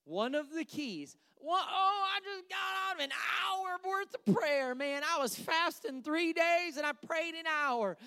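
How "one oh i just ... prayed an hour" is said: high, excited bragging; mock boastful believer. Preacher imitates someone bragging about long prayer, high excited. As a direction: brag about the hour of prayer.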